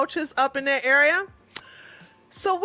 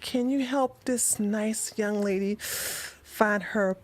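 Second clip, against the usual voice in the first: slow, much softer sultry tease on "my cat is in my bedroom"; seductive purr; 3 semitones lower, 7 dB quieter, slower than usual. Retold or imitated. Friend purring at the officer about her cat, slow and soft. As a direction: linger on "my cat is in my bedroom" like a come-on. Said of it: "she begins to feel herself like".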